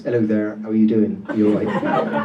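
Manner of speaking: low-pitched Dorset greeting; Dorset accent. Shown as a demonstration. Comic demonstrates his original accent to the crowd, Dorset accent. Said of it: "my original accent was a Dorset accent".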